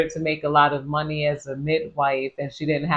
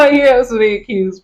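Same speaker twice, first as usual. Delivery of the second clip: laughing; 7 semitones higher, 9 dB louder, faster than usual. Real, not acted. Laughing aside.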